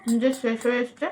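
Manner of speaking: ordinary delivery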